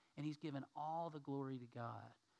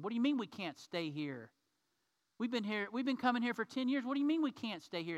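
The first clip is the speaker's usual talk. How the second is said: rising, outraged protest, getting louder and higher; indignant players; 8 semitones higher, 6 dB louder than usual. Acted. Dodger players refused at their usual hotel, indignant and loud. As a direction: build from disbelief to loud outrage. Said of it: "And they're mad now".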